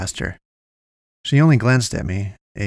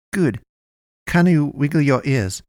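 higher, stilted character voice; odd little medic's voice; 4 semitones higher than usual. A narrator's reading. Miguel continues testing his patient, stilted. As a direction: ask it pitched up, like a small odd medic.

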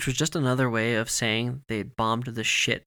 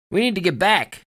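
loud, raised urgent call; shouted order; 7 semitones higher, 8 dB louder than usual. A narrator's reading. Soldier ordering the others back, shouting. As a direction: call it out to the whole squad. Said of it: "Dugan shouted at the other soldiers".

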